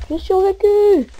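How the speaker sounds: high, slow relieved cheer; soulagement ravi. Real, not acted. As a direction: savour surviving. Relieved after surviving attack. (joie soulagée, aiguë et lente)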